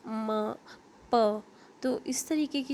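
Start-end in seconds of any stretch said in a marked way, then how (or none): 0.1-0.6 म sounded on its own, held briefly; isolated म sound. Shown as a demonstration. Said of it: "जो भी हम साउंड निकालें". 1.1-1.4 प sounded on its own; isolated प sound. Shown as a demonstration. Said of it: "जो भी हम साउंड निकालें".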